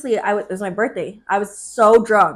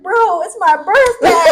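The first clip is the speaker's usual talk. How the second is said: high, drawn-out indignant whine, half laughing; drunken protest; 8 semitones higher, 6 dB louder, slower than usual. Retold or imitated. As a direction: protest slowly in a raised pitch, hammed up. Retold drunken protest in car quarrel.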